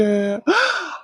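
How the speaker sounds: sharp high gasp of shock. Acted. Uncle gasps seeing the pile of cash, a high shocked gasp. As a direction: suck in air at the sight of money.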